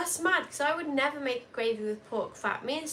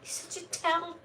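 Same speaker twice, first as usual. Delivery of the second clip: mock indignation; 6 semitones higher, 3 dB louder than usual. Real, not acted. Co-host calling out the telltale, mock indignation. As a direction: wail it high, as if betrayed. Mock outrage at being told on.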